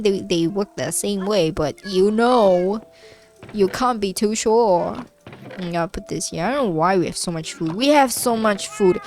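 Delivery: usual speaking voice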